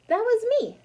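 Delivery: high, loud, eager; bright proud confession; proud admission. Acted. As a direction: pipe up proudly, pleased. Amelia Bedelia proudly admits.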